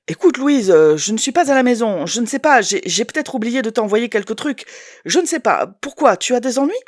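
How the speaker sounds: stammer, caught out; flustered ex. Acted. Ex-husband caught out on the phone, lightly flustered.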